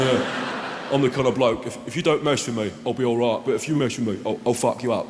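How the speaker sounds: low, menacing hard-man voice. Retold or imitated. Comedian imitates a wall puncher on TV, low and menacing. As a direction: lean in like a thug threatening. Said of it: "Guy's going".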